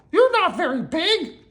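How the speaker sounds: very high mouse voice; little Mouse. A narrator's reading. Mouse challenges the tiny monster, squeaky disbelief. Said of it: "said Mouse".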